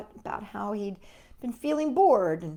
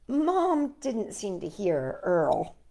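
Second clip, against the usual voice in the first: ordinary delivery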